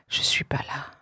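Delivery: chuchoté, souffle sans voix; murmure-le comme un secret; whisper. Acted. Poème, murmure intime. (chuchoté, presque inaudible)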